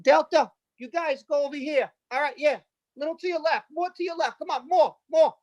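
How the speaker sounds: high-pitched frantic controller waving planes in; Italian air traffic controller. Retold or imitated. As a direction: shout directions like a flustered Italian controller. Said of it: "this is the reenactment what happened".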